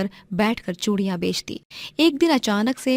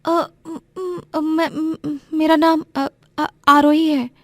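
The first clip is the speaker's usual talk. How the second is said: shy stammer; 8 semitones higher, 3 dB louder than usual. Acted. Shy girl stammers her name.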